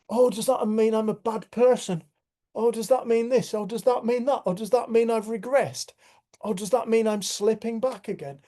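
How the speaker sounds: mock-anxious self-questioning; anxious inner voice. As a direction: mimic your own panicky thoughts, hammed up. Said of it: "I'll be like".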